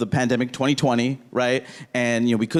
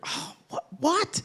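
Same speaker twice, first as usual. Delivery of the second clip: shocked dad; 10 semitones higher than usual. Retold or imitated.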